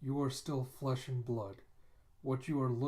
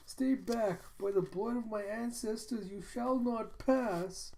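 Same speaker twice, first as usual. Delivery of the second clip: defiant battle cry; 10 semitones higher than usual. Retold or imitated. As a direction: hold the line against the monster. Kippa defying the monster.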